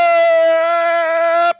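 sudden high startled cry; startled yelp. Real, not acted. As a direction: jump and yelp in fright. Startled by figure in game.

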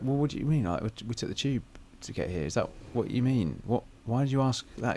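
lightly puzzled retold question; mildly baffled, querying back. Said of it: "she was a bit puzzled by it".

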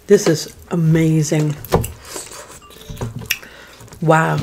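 talking between bites of fries; chewing. Real, not acted. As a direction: mouth partly full. Seen 0: Woman forks fries between bites; lips move as if chewing.

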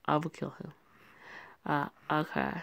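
ordinary delivery

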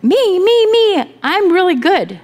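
high, loud me-me-me plea; eager kid pleading. Retold or imitated. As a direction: beg to be picked like an eager child. Eager kid begging to be picked.

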